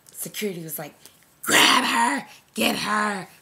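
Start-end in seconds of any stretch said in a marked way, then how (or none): 1.4-3.3 loud guard yelling commands; sudden loud shouts, acted out; shouted order. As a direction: yell it like security giving orders. Acts out security shouting orders.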